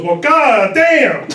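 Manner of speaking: slow, loud, laughing exclamation; delighted shout. Retold or imitated. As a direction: drag out a stunned, happy curse. Pastor hears of thousand-dollar donation.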